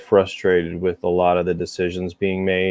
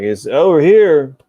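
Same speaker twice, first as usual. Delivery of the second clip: quoting a movie character; 5 semitones higher, 7 dB louder than usual. Retold or imitated. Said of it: "He's like".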